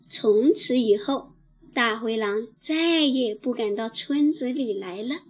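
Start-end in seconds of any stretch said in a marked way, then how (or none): none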